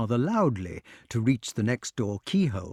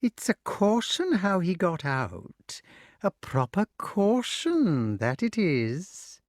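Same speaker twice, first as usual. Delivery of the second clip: musing witch; 7 semitones higher, slower than usual. A narrator's reading. Witch muses.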